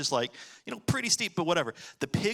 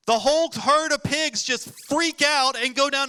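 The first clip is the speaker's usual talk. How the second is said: dramatic climax; 9 semitones higher, 6 dB louder than usual. Real, not acted. Pigs stampede climax.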